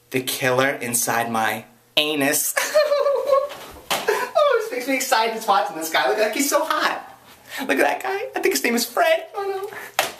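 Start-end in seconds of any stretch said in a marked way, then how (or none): 2.6-3.6 high, giddy burst of giggling; high giggle. Real, not acted. A vlogger giggling at the title. 3.9-10.1 much higher, giddy gushing; giddy swooning. Real, not acted. A vlogger swooning over the hot actor, giddy.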